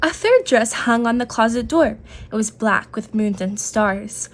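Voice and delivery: ordinary delivery, nothing marked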